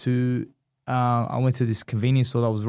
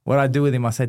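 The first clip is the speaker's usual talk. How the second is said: ordinary talk, nothing marked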